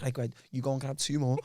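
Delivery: low, hushed aside to a workmate; low furtive murmur. Retold or imitated. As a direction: murmur it low, like a secret errand. Acting out sneaky ice pop runs.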